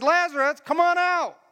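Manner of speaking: high, ringing summons; loud command. Acted. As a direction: call out for everyone around. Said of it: "He said it loud".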